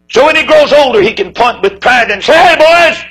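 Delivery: put-on high and loud, rising to Hey, boys; proud climax. Father proud of his son before the boys, high and loud. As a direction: swell with a father's pride.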